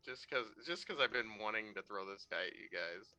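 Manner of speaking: ordinary talk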